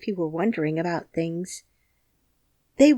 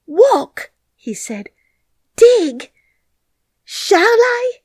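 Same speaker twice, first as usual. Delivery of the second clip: eager disbelief; 13 semitones higher, 9 dB louder, much slower than usual. Acted. Colin flushed at the thought of walking.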